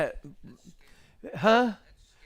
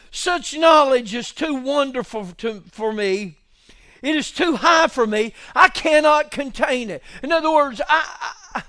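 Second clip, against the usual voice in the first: climbing pitch and volume; mounting pulpit fervour; building preaching; 7 semitones higher, 7 dB louder than usual. Acted. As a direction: preach toward a peak. Preaching builds toward shout.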